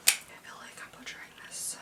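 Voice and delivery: whispering to herself; breathy self-conscious whisper; whispered aside. Real, not acted.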